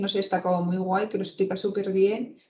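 ordinary delivery

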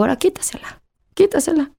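breathy whispered instruction, then rising; urgent whisper. Retold or imitated. Mother telling staff to take the apple away, whispered. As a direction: hush it out like a secret cue.

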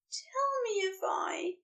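high, breathless whisper; angel's whisper. Acted. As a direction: barely dare to ask it, whispered. Aziraphale whispers to Crowley.

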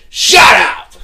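high, loud imitation of an angry woman; mock angry yell. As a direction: yell it back, hammed-up. Acting woman snapping back.

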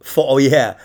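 normal talking voice

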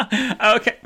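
high laugh into okay. Real, not acted. After her comeback to David, laughing it off. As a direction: crack up at your own joke.

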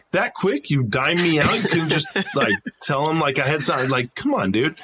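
incredulous complaint to a buddy; retold exasperation. Soldier protesting to the one who told on him, exasperated.

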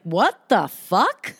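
slow, drawn-out mock disbelief; incredulous. Reacting to a thumbs-up text, slowly incredulous. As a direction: stretch out each word in disbelief.

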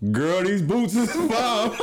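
high, very slow, hammed-up swagger; trying too hard cool. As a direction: oversell the slang like a try-hard. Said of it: "hit her with the".

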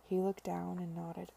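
usual speaking voice

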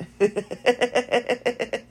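a short, high, real laugh; amused high laugh. Real, not acted. Laughing at her fasciologist's change-agent idea, high real laugh. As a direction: laugh at your own joke, high-pitched.

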